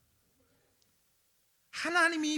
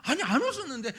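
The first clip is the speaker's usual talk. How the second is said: God and Sarah bickering, laughing through it; a teasing back-and-forth, half laughing; playful quarrel; 3 semitones higher, 9 dB louder than usual. Acted. Preacher acts God and Sarah arguing over the laugh, laughing.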